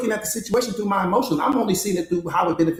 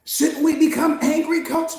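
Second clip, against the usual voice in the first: higher, pleading voice of a doubting student; 8 semitones higher, slower than usual. Acted. Coach voicing a student asking whether to get angry, higher and pleading. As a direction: ask it like a student who wants permission to be angry.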